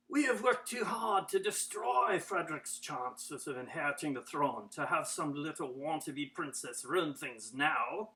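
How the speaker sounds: low, soft menace; menacing. Acted. Schemer warning the wannabe princess off the throne, menacing. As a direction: threaten her in a low, contemptuous voice. Villain threatens Cinderella.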